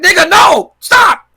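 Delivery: high loud yelling protest; panicked shout. Retold or imitated. Wife yelling at husband to stop, high panicked shout. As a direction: shriek it to make him stop. Wife pushed against fridge.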